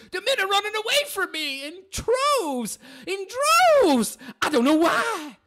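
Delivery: hammed-up crying self-pity; mock whiny woman. Continues mocking the woman.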